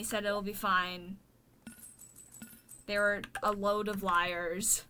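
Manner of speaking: usual speaking voice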